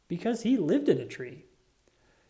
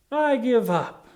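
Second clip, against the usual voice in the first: higher, louder frustrated outburst; exasperated; 8 semitones higher, 5 dB louder, slower than usual. A narrator's reading. Lucy gives up on her stick, exasperated and loud. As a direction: throw it away in frustration.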